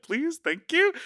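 squeaky, mortified politeness; sheepish high voice. Retold or imitated. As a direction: pitch way up, embarrassed and polite. Embarrassed cashier buy after accident.

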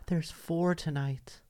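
slow, soft awed neighbor; slow hushed neighbor. Retold or imitated. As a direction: hush it like it's a secret.

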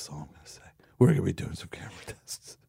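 secretive stage whisper. Acted. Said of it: "teasing secret, whispered aside".

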